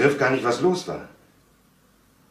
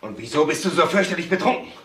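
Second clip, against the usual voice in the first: reproachful question; 6 semitones higher than usual. Acted. Son confronts drunk father.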